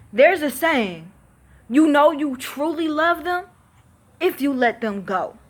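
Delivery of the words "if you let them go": ordinary talk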